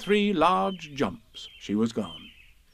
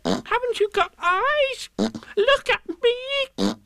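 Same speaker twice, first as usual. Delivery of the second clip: very high, drawn-out squealing shout; tiny creature's exasperated high shout; 4 dB louder, much slower than usual. A narrator's reading. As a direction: squeal each word out slowly. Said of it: "shouted Piglet".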